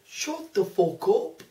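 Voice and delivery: put-on slow Scouse accent take. Repeating the line on request, slow Scouse accent. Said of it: "Say it again".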